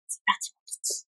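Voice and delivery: soft breathy whisper; ASMR whisper. Shown as a demonstration. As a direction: whisper it close to the mic. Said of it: "A S M R".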